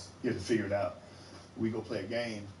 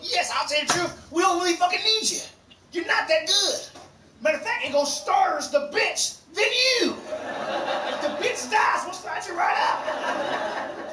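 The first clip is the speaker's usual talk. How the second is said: angry coach; slower than usual. Retold or imitated. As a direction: tear into the kid. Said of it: "No, I don't".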